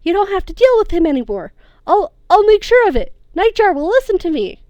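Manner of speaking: high, loud breathless pleading with mother; desperate pleading. A narrator's reading. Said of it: "he gasped out".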